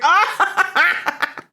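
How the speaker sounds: bright high-pitched giggle; high laugh. Real, not acted. Laughing at chat joke.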